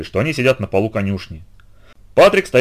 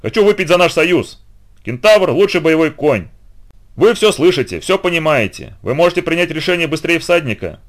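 high, forceful oratory; rally them to your cause with a toast; rousing proclamation; 7 semitones higher than usual. A narrator's reading. Heracles pitches an alliance to the centaurs, rousing proclamation.

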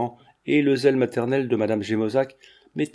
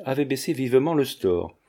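plain reading voice

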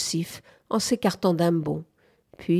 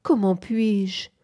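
slow, drawn-out helpless question; anguished, dying; 3 semitones higher, slower than usual. A narrator's reading. Woman overwhelmed by her suitor, anguished and faint. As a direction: drag it out as if barely conscious. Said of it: "comme quelqu'un qui va mourir".

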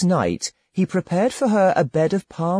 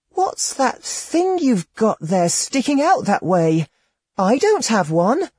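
put-on higher, wondering girlish voice; young girl Alibek; 9 semitones higher than usual. A narrator's reading. Naive girl asking the hermit about his body, higher innocent voice. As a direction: ask it wide-eyed like an innocent girl.

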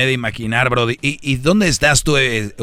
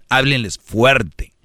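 "háblenles fuerte" marcado y lento; énfasis lento; much slower than usual. Shown as a demonstration. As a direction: recalca "háblenles" despacio. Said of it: "¿Qué es hablar fuerte?". (frase enseñada con énfasis)

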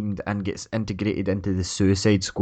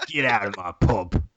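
mock East End pub landlord; East End accent; 2 semitones lower than usual. Villain ordering someone out of his pub, East End accent. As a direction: throw them out in hammed-up Cockney. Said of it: "East End Stroke".

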